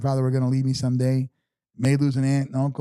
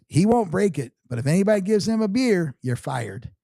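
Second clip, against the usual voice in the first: high nasal imitation, dropping at the end; nasally voice; 6 semitones higher than usual. Buddy warning the barmaids, high nasally voice. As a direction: lay down the rule in his nasally voice. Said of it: "he's got a little nasally voice".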